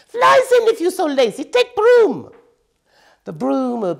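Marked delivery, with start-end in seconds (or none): 0.1-2.3 high loud fed-up squeak; exasperated hamster. A narrator's reading. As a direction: snap at her to use the broom.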